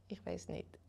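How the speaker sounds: unvoiced little "don't know" to himself; whispered aside. Real, not acted. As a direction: breathe it out, half to yourself. Unsure aside, voice drops away. (geflüstert, wie zu sich selbst)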